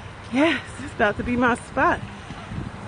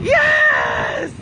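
a high, joyful shout of yes; excited yes; 7 semitones higher than usual. Real, not acted. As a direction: cheer it out like you just won. Cheering at time left.

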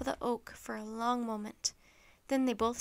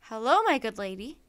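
Ben's greeting; 7 dB louder than usual. Acted. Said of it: "he heard Ben say".

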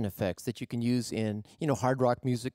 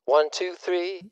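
tinny bullhorn voice, louder; megaphone effect; 5 semitones higher, 4 dB louder, slower than usual. Shown as a demonstration. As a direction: call it through a megaphone. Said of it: "one from the bank of megaphones".

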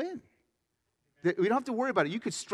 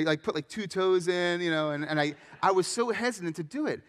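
ordinary talk, nothing marked

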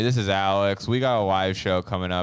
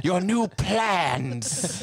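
posh British officer; 9 semitones higher, much slower than usual. Retold or imitated. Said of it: "British people are like".

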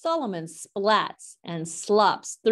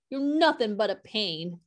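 plain reading voice

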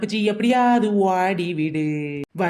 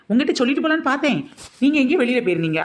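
fast, higher rushed explanation; hurried nervous excuse; 5 semitones higher, faster than usual. Acted. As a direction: gabble the excuse to get it over with. Nervous excuse to mother-in-law. (பதற்றத்துடன் வேகமாக சாக்கு சொல்லுதல்)

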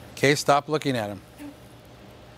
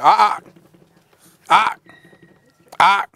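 short, louder "ah" sounds to stop the dog; sharp correction; 4 semitones higher, 5 dB louder than usual. Real, not acted. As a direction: cut the dog off with a clipped "ah". Correcting the dog's tantrum.

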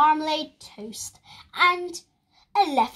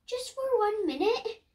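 put-on high child voice; 10 semitones higher than usual. Lester pleads with Mum.

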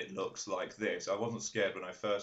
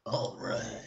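creepy Solid Snake impression. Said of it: "Creepy Solid Snake voice".

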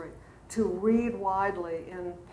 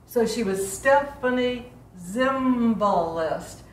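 slow, beat out STEPH-a-nie ZIM-ba-list as two dactyls; teacher chanting the name in dactyls; dactylic rhythm; 2 semitones higher, slower than usual. Shown as a demonstration. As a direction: tap each stressed syllable, let the two after it fall away. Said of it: "one stressed syllable followed by two unstressed syllables".

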